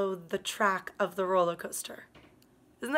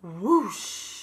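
whoosh; 2 semitones lower, 7 dB louder than usual. Acted. Train plunges down.

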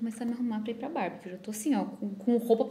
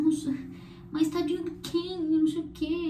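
mocking quote; 7 semitones higher than usual. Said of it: "Daí eles ficam".

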